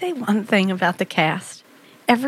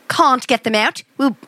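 put-on amateur British accent for a king; attempted British accent; 2 semitones higher than usual. Co-host takes over the king's line, attempted British accent. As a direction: try reading the king in British. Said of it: "I can't do a British accent".

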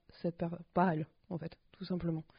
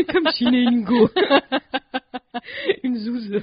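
talking through laughter; giggling out the words; laughing; 6 semitones higher, 13 dB louder, slower than usual. Real, not acted. Laughing at their own joke.

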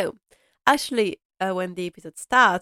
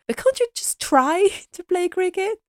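put-on much higher, whiny, boyish plea with a laugh; childish pleading Robert; 12 semitones higher, 4 dB louder than usual. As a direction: beg like a child who wants his game. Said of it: "Robert is feeling like".

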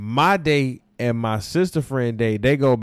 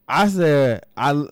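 ordinary talk

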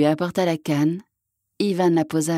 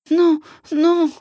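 high-pitched cry of protest; alarmed cry; 11 semitones higher, 4 dB louder than usual. A narrator's reading. Mother wolf stops hunter killing her cubs, high alarmed cry. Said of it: "mais une voix cria".

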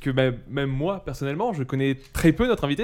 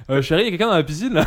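propriétaire affolé; 5 semitones higher, 5 dB louder, faster than usual. Pretend. Voices the startled garden owner.

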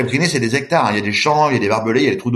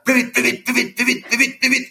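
very high, chanted push; urging shout; 12 semitones higher than usual. Retold or imitated. Boatman chanting at the swimmer to go faster, very high-pitched. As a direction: drum it into him, over and over.

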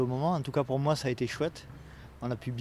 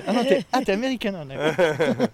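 mock surprise; 5 semitones higher, 6 dB louder than usual. Real, not acted. Mock surprise while teasing guest.

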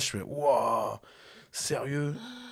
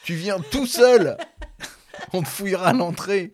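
sing-song high mock menace, laughing; hammed-up movie threat; 8 semitones higher, 6 dB louder than usual. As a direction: ham up the gangster line in a sing-song. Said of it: "c'est vraiment le truc d'un film".

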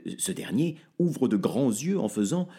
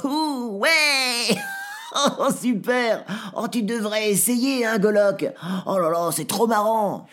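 very high squealing, laughing creature; gleeful dwarf; 12 semitones higher, 5 dB louder than usual. Acted. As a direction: bounce and squeal with glee. Zladi bouncing on mattress. (nain qui rit aux éclats, très aigu et fort)

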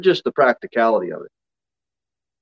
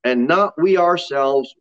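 slow, drawn-out psalm reading; higher, measured proclamation; scripture reading; 5 semitones higher than usual. Real, not acted. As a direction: read it out to the congregation, stretching the key words. Reads the psalm aloud.

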